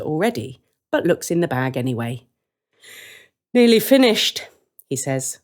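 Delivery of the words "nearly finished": a lightly voiced glum brother; Hansel's voice. Brother checking the empty bag, resigned. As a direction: sigh it out, resigned.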